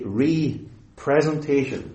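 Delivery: "representation" as ree-presentation; separated RE prefix. Shown as a demonstration. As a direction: lean on a long "ree" opening "representation". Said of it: "you could say representation".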